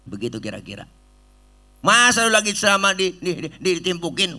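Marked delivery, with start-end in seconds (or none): 1.8-4.4 indignant. Acted. Retelling the stoning indignantly.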